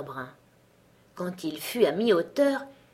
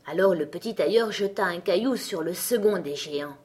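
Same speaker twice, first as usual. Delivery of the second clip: usual speaking voice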